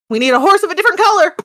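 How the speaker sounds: high, fast, loud doorman impression; Wizard of Oz doorman. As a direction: squeak it out like the Oz gatekeeper. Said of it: "And he went".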